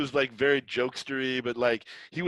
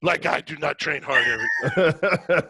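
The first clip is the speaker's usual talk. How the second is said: laughing while quoting; 4 semitones higher, 7 dB louder, slower than usual. Retold or imitated. Friend's mock complaint, laughing.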